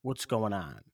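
low, soft re-enactment; laid-back self-quote. Retold or imitated. Host retells how he asked his co-host, low-key. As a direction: quote yourself quietly, dropped well down in pitch. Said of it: "I was like".

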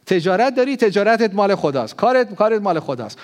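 loud, high exhortation to the congregation; full-force preaching. Acted.

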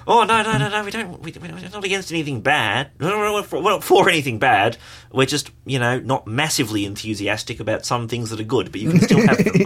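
put-on high-pitched jokey Kiwi accent; New Zealand accent. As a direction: speak as a cheery Kiwi politician. Voicing NZ party, Kiwi impression.

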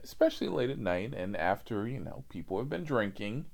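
normal talking voice

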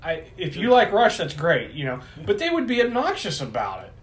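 ordinary talk, nothing marked

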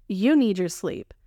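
ordinary talk

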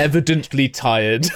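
ordinary talk, nothing marked

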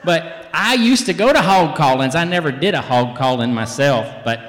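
loud Southern twang, swooping high at the end; Southern accent. Shown as a demonstration. Telling kids about hog callings, loud Southern accent. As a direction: holler it with big Southern gusto. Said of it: "in my Southern accent".

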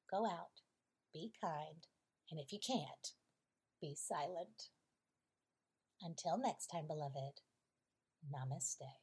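soft, hushed closing words to listeners; storyteller softly sending listeners off; gentle moral. Acted. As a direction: soothe them with the lesson.

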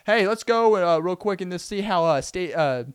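high, loud, eager host voice; video narrator. Retold or imitated. Narrator cuts in to show the hot method, loud and high. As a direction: jump in loud and high like a presenter. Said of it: "then the narrator would go".

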